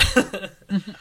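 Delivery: high breathless laughter; bursting laugh. Real, not acted. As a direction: crack up mid-intro. Intro breaks down into laughter.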